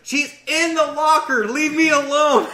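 very high, slow, frantic mock yelling; hammed-up terrified shriek; panicked snitch. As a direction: sell out a friend to save yourself. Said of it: "And it's like".

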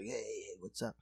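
put-on very soft, low drunk greeting; drunk bar blowhard. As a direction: imitate a drunk sidling up, low and quiet. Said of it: "he's just drunk".

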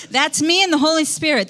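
light laugh through the words. Real, not acted. Pastor laughing to congregation about her secret. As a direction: chuckle it out, sharing a secret.

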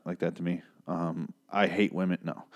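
normal talking voice

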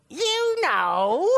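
high, swooping parrot squawk. Acted. Parrot taunting the lady on the third day, sly high squawk. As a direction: squawk knowingly, swooping down and back up. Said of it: "just like that".

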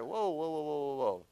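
stunned crowd backing away; mock shocked crowd. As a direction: play the stunned crowd pulling back. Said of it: "they're like".

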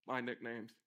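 ordinary delivery

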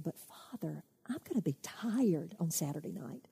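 hushed, fearful plea to his father; scared whisper. Acted. Frightened son whispering to his father.

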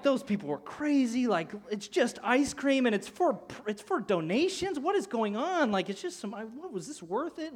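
high-pitched bewilderment, retold; baffled, high-voiced reaction; incredulous. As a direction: question it all in disbelief, pitch up. Retelling the table's bewildered reaction.

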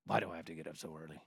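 mock groggy whine. Imitated grumble at alarm.

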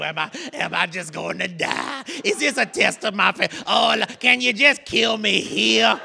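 hammed-up crying plea to the Lord; mock tearful prayer. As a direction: wail and bargain with God, comically.

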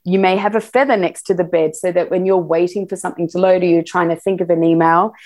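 normal talking voice

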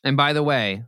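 normal talking voice

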